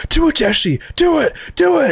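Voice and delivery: very high, urgent mock scream; imitated phone scream. Walt imitated screaming to Jesse over the phone, urgently. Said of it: "he's screaming over the phone".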